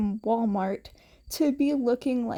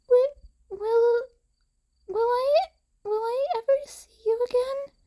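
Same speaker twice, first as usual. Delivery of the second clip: put-on high child voice, breaking and crying, then rushing out fast; a little girl sobbing out the question; through tears; 12 semitones higher, faster than usual. Eri asking Izuku through tears if they'll meet again, crying child voice. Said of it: "Eri asked through tears".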